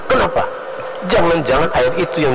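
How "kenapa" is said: high-pitched, snapped KENAPA; curt, high challenge; snapping intonation. Shown as a demonstration. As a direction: throw out "Kenapa" abruptly, pitched way up. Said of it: "ngegas kayak itu".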